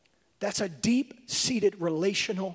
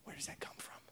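hushed, softer question; whispered question; 7 semitones lower, 19 dB quieter, faster than usual. Real, not acted. Preacher whispering a question to the congregation. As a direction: drop to a whisper and ask.

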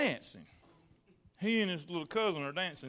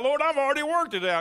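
mock smug prayer; 7 semitones higher, 7 dB louder, faster than usual.

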